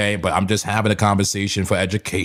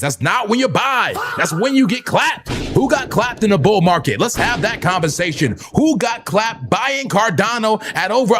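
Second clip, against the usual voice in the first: higher, fired-up sermon voice; mock preaching; 10 semitones higher than usual. Streamer preaches to his chat as the Zen Center congregation, fired-up mock sermon. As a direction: preach it like a revival tent.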